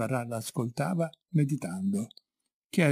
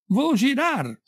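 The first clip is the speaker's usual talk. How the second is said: high-pitched conductor's call; repeated shout; 11 semitones higher, 4 dB louder, slower than usual. A narrator's reading. Conductor to the lady who didn't move, shouted. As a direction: sing out the stop, high and long. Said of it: "ripeté".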